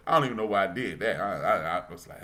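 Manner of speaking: usual speaking voice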